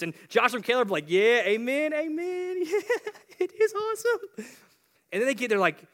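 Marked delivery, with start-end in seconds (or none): 1.1-4.5 very high, squealy cheering; gleeful agreement. Acted. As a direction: chime in high-pitched, bursting with glee. Joshua and Caleb cheer the report.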